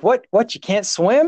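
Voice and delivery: high-pitched mock disbelief; incredulous question. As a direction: imitate a baffled rescuer. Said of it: "you know what he asked me".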